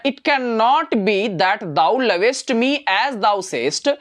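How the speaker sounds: very slow, drawn-out verse; slow poem recitation. Shown as a demonstration. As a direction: recite it slowly line by line. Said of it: "जरा ध्यान से समझना". (धीमा कविता पाठ)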